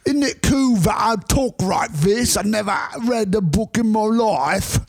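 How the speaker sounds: marbles-in-the-mouth mock Londoner, high; London accent. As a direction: bray it in mangled London English. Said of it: "they have a lot of shit in their mouth".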